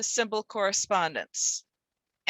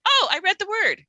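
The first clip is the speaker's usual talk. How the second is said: higher and louder, delighted discovery; mock eureka; 9 semitones higher, 6 dB louder than usual. Shown as a demonstration. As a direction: act the lightbulb moment. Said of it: "eureka moment".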